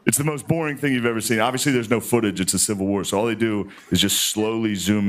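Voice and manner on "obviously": ordinary talk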